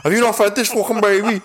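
high, fast laughing yell; laughing shout. Pretend. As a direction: yell it while cracking up. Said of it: "Scouse dad".

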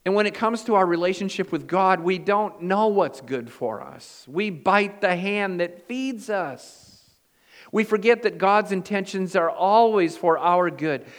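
full-voiced sermon climax; preaching. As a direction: drive the point home to the congregation.

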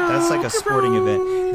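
high, loud stadium cheer; sports announcer. Pretend. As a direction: boom it like an arena announcer.

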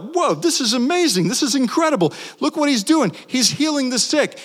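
high, amazed Whoa, this is amazing; amazed crowd. Retold or imitated. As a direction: gasp in wonder at Jesus. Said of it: "we're like".

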